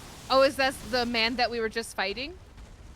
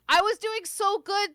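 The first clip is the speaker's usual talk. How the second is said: excited; 10 semitones higher, 7 dB louder than usual. Real, not acted. Protesting a boss death.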